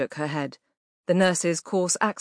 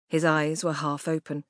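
usual speaking voice

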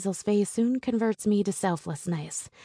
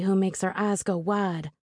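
plain reading voice

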